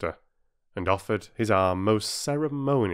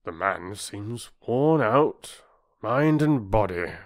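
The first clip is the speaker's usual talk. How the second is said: slow, lightly voiced serious doctor; doctor giving his verdict; grave doctor; 3 semitones higher, slower than usual.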